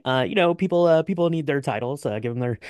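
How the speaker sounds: ordinary delivery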